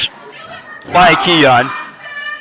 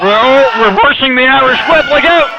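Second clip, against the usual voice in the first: rising high excited call; 6 semitones higher than usual. Real, not acted. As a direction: build to the warning. Reversal call.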